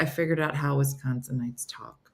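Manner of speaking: imitating a hushed, half-whispered reveal; hushed confiding. Retelling her reporting her discovery, hushed and confiding.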